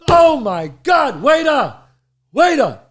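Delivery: loud, high mock alarm; calling out, alarmed. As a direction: summon the waiter in a staged outcry. Friend acted calling for the waiter.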